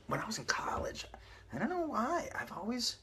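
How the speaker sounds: ordinary delivery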